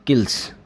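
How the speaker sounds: किल्स ending in a hissed s; wrong s ending. Shown as a demonstration. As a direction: close किल्स on a plain unvoiced s. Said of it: "ऐसे नहीं बोलना है आपको".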